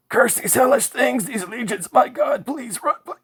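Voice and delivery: very high, loud final scream; sad scared scream. A narrator's reading. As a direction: cry out as the things take you. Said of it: "sad, scared, loud scream".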